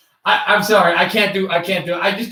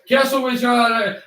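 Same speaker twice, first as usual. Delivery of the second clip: normal talking voice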